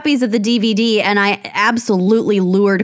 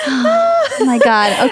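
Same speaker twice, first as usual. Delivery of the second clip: laughing; much slower than usual. Real, not acted. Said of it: "What's wrong with me today".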